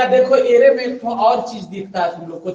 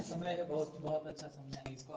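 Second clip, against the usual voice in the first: whisper; 6 semitones lower, 24 dB quieter than usual. Real, not acted. Teacher aside to class.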